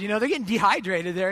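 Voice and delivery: ordinary delivery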